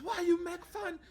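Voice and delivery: put-on loud, high Chinese-accented outburst; shouted imitation of a Chinese accent; angry Chinese accent. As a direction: yell it as an offended Chinese woman. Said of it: "she goes".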